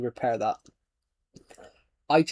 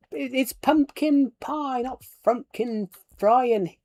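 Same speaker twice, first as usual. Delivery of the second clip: high, slow, wide-eyed giant voice; BFG giant quote; 9 semitones higher, slower than usual. Retold or imitated. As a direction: slowly quote the friendly giant, pitched high. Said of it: "do you guys even know where that's from".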